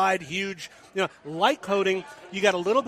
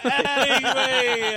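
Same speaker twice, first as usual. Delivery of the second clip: laughing; 5 semitones higher, much slower than usual. Real, not acted. Laughing after the Australian joke.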